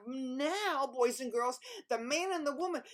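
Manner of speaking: usual speaking voice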